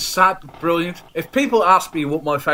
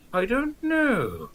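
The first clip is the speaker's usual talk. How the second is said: clueless shrug; 6 semitones higher, 8 dB quieter, much slower than usual. Retold or imitated. Imagining being asked his films.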